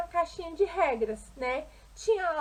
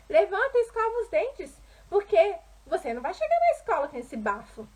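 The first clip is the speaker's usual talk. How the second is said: higher, louder scolding mother; critical mother; 6 semitones higher, 4 dB louder than usual. Acted. Mother scolding her daughter on waking up, critical and higher-pitched. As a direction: voice a mother's brisk morning reproach. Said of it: "já falava o quê pra ela?".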